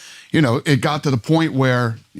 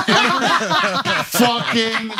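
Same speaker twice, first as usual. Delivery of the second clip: laughing; 9 semitones higher, much slower than usual. Real, not acted. Laughing while complaining about weddings.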